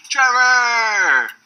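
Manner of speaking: calling his name out, high and loud across the bar; delighted greeting yell; excited shout. Retold or imitated. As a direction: shout the name in happy recognition. Bill spots him, calls name.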